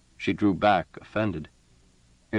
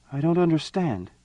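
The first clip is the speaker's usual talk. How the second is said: raised, bewildered queen voice; puzzled empress; 9 semitones higher than usual. A narrator's reading. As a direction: sound genuinely puzzled.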